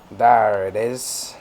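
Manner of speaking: put-on slow, drawn-out pirate accent. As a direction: drag it out like a pirate. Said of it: "pirate accent all of a sudden".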